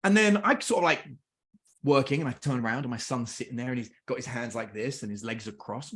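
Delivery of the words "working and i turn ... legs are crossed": ordinary talk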